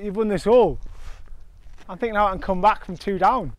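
ordinary delivery

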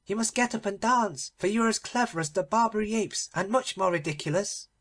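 high, mocking children's chorus; children crying out. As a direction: taunt him like a gang of kids. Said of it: "cried all the children".

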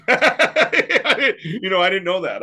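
laughing. Real, not acted. Laughing at host's compliment.

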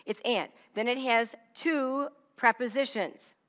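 ordinary talk, nothing marked

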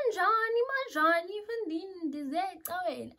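high, exclaimed comeback; acted high-pitched protest; incredulous retort. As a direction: throw the question back, loud then easing off. Acted reply in a heated exchange.

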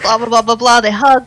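ordinary delivery

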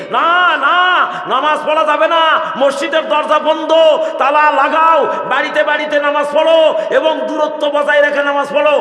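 high, loud barked orders; mocking stern decree. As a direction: shout the ban like an official. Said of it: "নিয়ম করছে কি".

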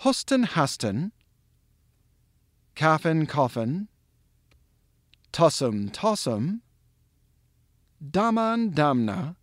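slow word pairs with long pauses between; pronunciation drill. Shown as a demonstration. Teacher drilling the word's parts with learners, slow and separated. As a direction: drill each pair separately, leaving room to repeat. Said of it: "with that we can drill them".

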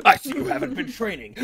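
high, hammed-up anime voice; anime character. Game master spoofing Dragon Ball Z, high character voice. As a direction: taunt like an anime fighter. Imitates an anime villain line.

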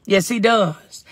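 ordinary delivery

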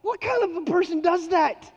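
high, loud mock outrage; high incredulous cry; mock disbelief. Real, not acted. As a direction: ask in playful disbelief.

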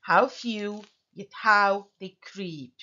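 "How few, yet how they creep" with stress on "few", "how" and "creep"; slow da-DUM beat, landing on "few", "how", "creep"; iambic stress. Shown as a demonstration. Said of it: "Unstressed followed by stressed".

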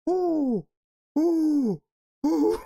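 very high-pitched "ooh" squeals; laughing squeals. Real, not acted. Viewer losing it at a video, laughing squeals. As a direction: squeal helplessly as a laughing fit builds.

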